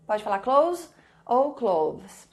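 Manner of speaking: normal talking voice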